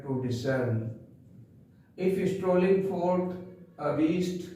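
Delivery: very slow, measured poem reading; poem recitation. Shown as a demonstration. Teacher reciting the poem for class ten, slow recitation. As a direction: recite each phrase slowly with pauses.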